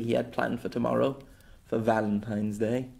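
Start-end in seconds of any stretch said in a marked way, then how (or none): none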